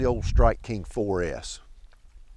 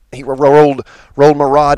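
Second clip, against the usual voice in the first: excited; 4 semitones higher, 11 dB louder than usual. Real, not acted. Fish rolls rod during fight.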